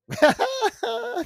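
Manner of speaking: real high cackle at his own joke; high laugh. Real, not acted. Vlogger cracking up at his own rhyme, a high cackle. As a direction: burst into a high, delighted laugh.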